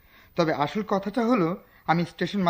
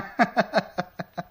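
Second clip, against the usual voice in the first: a sly, knowing laugh; knowing chuckle. Acted. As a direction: chuckle as if you know their secret. Stranger laughs after "nothing.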